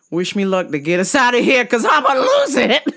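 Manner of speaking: half-laughing, pleading for luck; laughing plea. Real, not acted.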